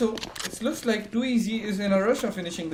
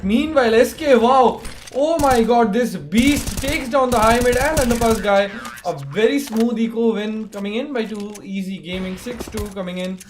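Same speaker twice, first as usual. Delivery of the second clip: loud, high hype call of a big play; excited esports caster; 4 semitones higher, 8 dB louder than usual. Real, not acted. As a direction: shout it like a caster losing it over a frag. Hyped play-by-play burst.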